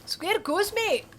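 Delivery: put-on quick Scottish accent quip; Scottish accent, challenging a fight. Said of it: "Escargot in a Scottish accent".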